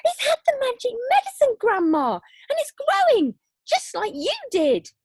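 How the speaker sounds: high, loud, fast cry; boy thrilled, shouting to grandma; excited shouting. Acted. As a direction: shout in delight, hopping about.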